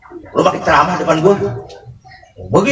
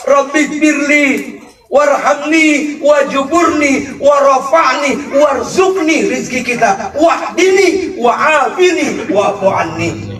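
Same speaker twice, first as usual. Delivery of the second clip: fervent prayer; 10 semitones higher, slower than usual. Shown as a demonstration. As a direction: cry the dua out with full fervour.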